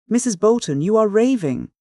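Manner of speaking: ordinary delivery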